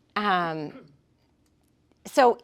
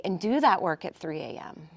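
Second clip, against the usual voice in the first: ordinary talk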